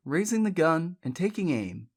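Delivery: ordinary delivery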